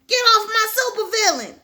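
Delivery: high, loud cartoon yell; mock hero shout. Hero yelling at the rival hero, loud cartoon shout. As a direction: shout it like a cartoon hero defending his villain.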